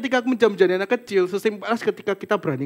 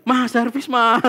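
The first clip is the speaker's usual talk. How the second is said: calling mom; 5 semitones higher, 4 dB louder, much slower than usual. Retold or imitated.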